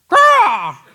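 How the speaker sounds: loud, high crow caw; a piercing imitated crow cry. Shown as a demonstration. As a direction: squawk it out like a crow. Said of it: "Like that".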